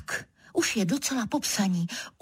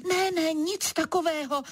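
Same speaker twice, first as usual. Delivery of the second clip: high-pitched mild outcry; crying out; 8 semitones higher than usual. A narrator's reading. Writer stopping the prowling leopard, crying out. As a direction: forbid it in a raised-pitch protest. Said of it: "Rozkřiknu se".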